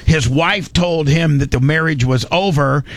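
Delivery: ordinary delivery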